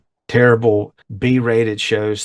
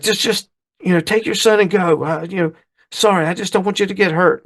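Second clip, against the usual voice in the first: mock timid backing down; 10 semitones higher than usual. Fonz faking cowardice.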